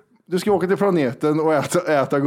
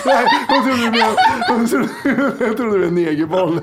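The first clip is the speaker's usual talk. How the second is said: higher, louder, laughing through the words; 6 semitones higher, 4 dB louder than usual. Real, not acted. Host confessing a misheard word to co-hosts, laughing. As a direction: barely get it out through laughter. Cracking up over a misunderstanding.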